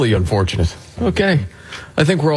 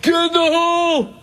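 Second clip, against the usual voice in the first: very high, stretched-out mock holler; hammed-up crowd yell; mock golf-fan yell. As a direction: imitate a heckler who thinks he's clever. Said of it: "anybody that yells".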